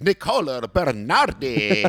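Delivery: Nicola Bernardi with a heavily rolled R, slow; rolled Italian name. Shown as a demonstration. Introducing his best friend with an Italian roll. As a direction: roll the R's in Bernardi hard. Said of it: "You just added, like, seventeen R's at the end".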